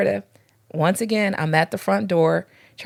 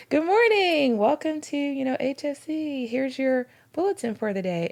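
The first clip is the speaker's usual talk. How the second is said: high, sing-song welcome; re-enacted church greeter's bright hello; cheery greeter; 7 semitones higher than usual. Greeter welcoming a couple, retold.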